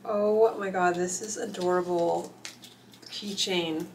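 ordinary delivery

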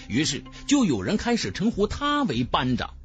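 ordinary delivery

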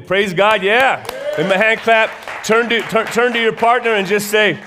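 loud, high call to the congregation, lifting the room; rousing call. Real, not acted. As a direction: pump up the church, loud and high.

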